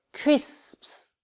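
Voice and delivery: crisps whispered, split nearly into two syllables; almost two syllables. Shown as a demonstration. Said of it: "comme deux syllabes".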